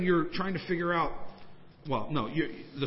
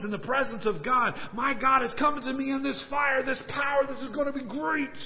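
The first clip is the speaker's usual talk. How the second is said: eager anticipation; 7 semitones higher, 3 dB louder than usual. Acted. Preacher voicing Elijah's excited hopes.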